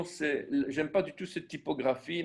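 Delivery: ordinary delivery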